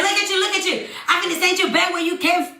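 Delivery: imitating his high, rushed rebuke; angry scolding. Man berating woman from car window, scolding.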